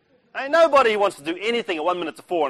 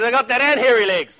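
cheeky boast; 6 semitones higher, 6 dB louder than usual. Retold or imitated. Cheeky reply to recruiting officer.

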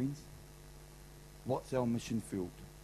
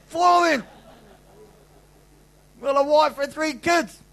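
loud, high, exasperated outburst; exasperated inner shout; 14 dB louder than usual. Retold or imitated. Husband venting to himself about a house full of women, shouting. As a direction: shout it like you've hit a brick wall. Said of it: "I'm thinking".

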